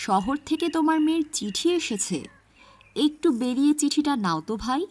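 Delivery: loud friendly call to a neighbour's house. Acted. Postman calling Rahim out of his house, loud call. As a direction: shout it across the yard, friendly.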